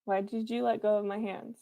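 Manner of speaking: ordinary delivery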